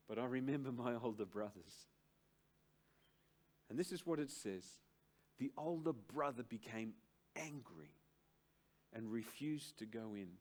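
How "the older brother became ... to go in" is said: calm reading of the verse. Acted. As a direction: read the text gently before expounding it. Scripture read quietly.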